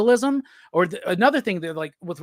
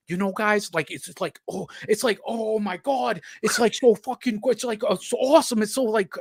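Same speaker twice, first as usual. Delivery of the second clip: a hint of a gushing, giddy Rob Liefeld, pitched up at the end; Rob Liefeld impression; 4 semitones higher than usual. Talking comics with his friend, a light gushing Liefeld impression. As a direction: gush like an overexcited fanboy, lightly voiced. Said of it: "I wish I could do a Rob Liefeld".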